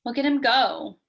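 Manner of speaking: ordinary delivery, nothing marked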